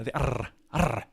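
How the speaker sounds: "arr" drawn out slowly as the end of "force"; rolled ending sound. Shown as a demonstration. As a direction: stretch out the r in "arr". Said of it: "the end of force".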